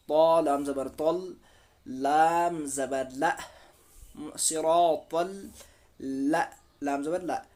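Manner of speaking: slow, drawn-out syllable-by-syllable recitation; slow tajweed spelling. Shown as a demonstration. Teacher spells out the word for learners, slow chanted spelling. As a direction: spell it out slowly, stretching long vowels. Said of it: "खींच कर एक अलिफ के बराबर पढ़ना है".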